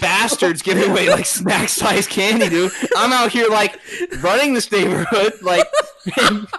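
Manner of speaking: put-on loud, high, ranting at a rude kid; mock furious rant. Retelling the rude kid at his door, loud and ranting. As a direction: blow up like an offended neighbor. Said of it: "you were so loud".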